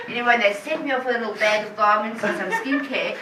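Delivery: ordinary talk, nothing marked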